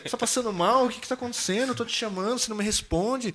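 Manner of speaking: high-pitched mock alarm; desperate. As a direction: fret frantically at someone who won't respond. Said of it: "ela tava assim, desesperada".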